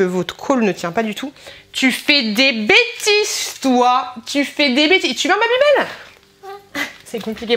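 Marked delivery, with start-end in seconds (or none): 1.7-6.9 sweet singsong talk to the little one. Real, not acted. Mother teasing her baby over the Babybel in high baby talk.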